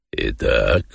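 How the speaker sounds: low, measured character voice; Воланд. A narrator's reading. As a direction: open low, addressing a guest. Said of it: "обратился к Маргарите Воланд". (низким голосом хозяина)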